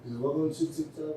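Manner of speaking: ordinary talk, nothing marked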